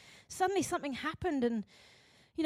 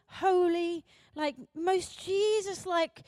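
normal talking voice

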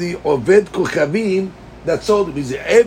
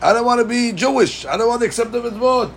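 mock protesting slave; 7 semitones higher than usual. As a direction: play the unwilling slave crying out, half-hearted. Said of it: "Even though he's screaming".

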